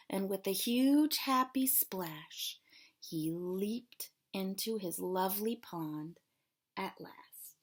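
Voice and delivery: put-on expressive read-aloud for kids; storybook reading. A narrator's reading. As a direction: read it like a picture book to children. Teacher reads storybook to class.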